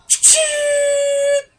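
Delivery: very high loud mock shutter chime; imitated camera click. Telling a friend about the phone camera, squealed "Ching!".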